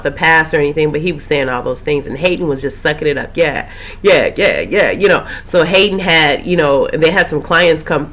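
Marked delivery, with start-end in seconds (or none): 3.4-4.9 mimicking an eager listener, yeahs swooping up and down; sing-song string of yeahs; eager agreement. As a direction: lap it up, mock-eagerly. Mimics Hayden eagerly lapping it up.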